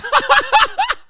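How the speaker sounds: a sudden high cackle of laughter; high burst of laughter. Real, not acted. Streamer laughing at the guy on screen, a high loud cackle.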